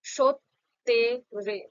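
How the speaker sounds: সত্যেরে said very slowly as সৎ-তে-রে; slow syllable demo. Shown as a demonstration. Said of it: "যেমনটা উচ্চারণ করলাম".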